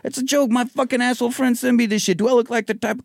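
put-on defensive boyfriend. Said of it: "And he's like".

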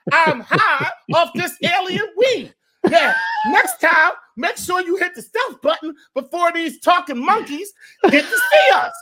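high-pitched goofy stoned alien voice; panicky, giggly alien who's high. Pretend. Alien caught high by humans.

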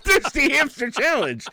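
very high, loud squeal of the title; squealing girl. Retold or imitated. Imitating the girl in the car with a loud high squeal. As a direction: shriek it like a delighted girl. Girl's squeal in retold video.

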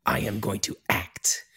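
whispered, hushed mock-grand declaration; mock actor whisper. Mocking Jack Palance's acting to his co-host, softly whispered. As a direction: breathe it out like a hammy actor. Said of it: "He is just like".

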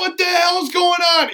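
high-pitched, blustering fake bafflement; feigned indignation. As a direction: act outraged to hide your guilt. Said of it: "Even though I know what I did".

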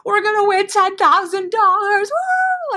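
mock the dorm kids going wild over the prize; excited crowd. Dorm students hearing they could win ten grand, screaming with excitement. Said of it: "everybody's going nuts".

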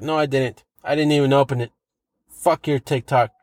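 normal talking voice

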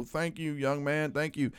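put-on a gentle grateful old man; soft old man thanks. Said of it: "shake my arm and go".

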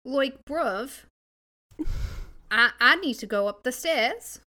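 loud, rising mock Aussie accent; Australian accent attempt. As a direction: push the Aussie slang out boldly. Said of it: "Sounds a little England to me".

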